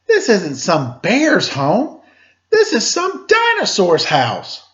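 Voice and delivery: high, rising dawning alarm; girl suddenly realizing danger; alarmed realization. Acted. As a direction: squeak it as the truth hits. Goldilocks realizing the truth.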